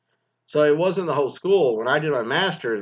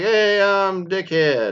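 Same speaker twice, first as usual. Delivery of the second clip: slow, higher goofy self-introduction; mock graduate intro; 6 semitones higher, 4 dB louder, much slower than usual. Kid at the mic announcing his own name, slow and goofy. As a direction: drag it out like a clueless kid at the mic.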